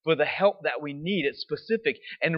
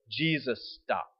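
slow, softer reveal; hushed, slow; 3 semitones lower, 4 dB quieter, slower than usual. Shown as a demonstration. Preacher pausing on the moment, slow and hushed. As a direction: let it land slowly. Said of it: "dramatic slow pause".

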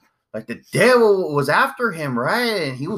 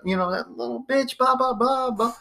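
raised pitch, mock fuming rant; mock angry dad; 8 semitones higher than usual. Dad griping to his son in the truck, mock angry. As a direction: vent like an irritated dad, hammed-up. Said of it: "And he was like".

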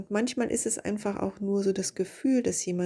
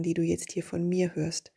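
usual speaking voice

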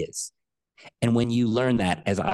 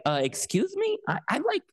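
high-pitched, indignant universe listing its credits; incredulous, offended creator with questioning lilts; affronted God; 9 semitones higher than usual. Retold or imitated. God answering someone who feels not good enough, affronted. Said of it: "the universe and God is like".

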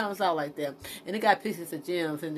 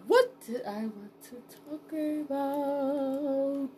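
slow, higher, stretched-out wondering; drawn-out musing; 7 semitones higher, much slower than usual. Real, not acted. Podcaster hunting for the next topic, musing in drawn-out words. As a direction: stretch each word while searching memory.

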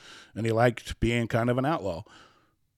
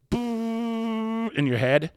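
a long, high, slow boom; half-mimicked brain zap, high and slow; mock explosion; 13 semitones higher, much slower than usual. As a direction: stretch out boom like a blast going off in the head. Drawn-out boom in retold quote.

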